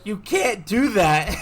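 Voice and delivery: high, loud, drawn-out protest; mock scolding yell. Boyfriend telling girlfriend off over the cat, mock scolding yell. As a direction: scold in exaggerated disbelief, amused.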